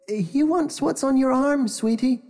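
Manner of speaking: high coaxing mother voice; sweet mother voice. A narrator's reading. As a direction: coax him gently like a mom.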